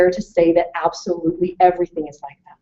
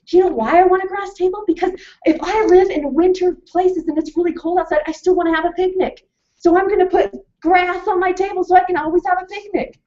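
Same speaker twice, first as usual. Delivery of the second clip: put-on high, fast, eager child voice; excited little kid; 10 semitones higher than usual. Lecturer imitating a little classmate, high and fast. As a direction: bubble over like a little kid explaining.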